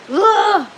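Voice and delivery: a high, loud, fed-up "ugh"; frustrated groan. Real, not acted. Streamer fed up with her broken grow setup, loud groan. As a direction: groan out the annoyance. Venting about everything going wrong.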